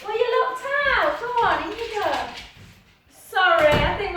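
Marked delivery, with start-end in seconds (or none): 0.0-2.1 high, louder pet talk; lilting voice for a pet; talking to the dog. Real, not acted. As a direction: fuss over the dog and send her in.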